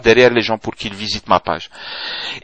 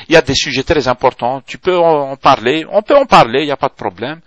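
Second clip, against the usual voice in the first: normal talking voice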